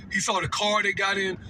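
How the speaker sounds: ordinary talk, nothing marked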